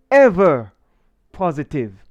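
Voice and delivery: confident slogan, loud first word, falling close; confident attitude. Shown as a demonstration. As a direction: punch EVER loudly, then settle firmly on positive. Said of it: "Say it with attitude, the attitude of confidence".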